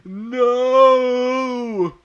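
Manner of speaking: very high, very loud drawn-out no; shouted. A narrator's reading. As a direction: bellow a long high no. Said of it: "he shouted".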